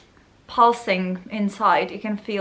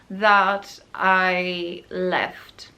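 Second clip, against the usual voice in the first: slow, breathy whispered that I left; whispered relief; 4 semitones lower, much slower than usual. Real, not acted. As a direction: let the relief out in a hush. Emotional aside before repetition.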